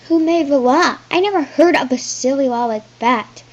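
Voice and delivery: loud, high indignant challenge; outraged princess. Acted. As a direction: scoff at the law. Princess defying the messenger.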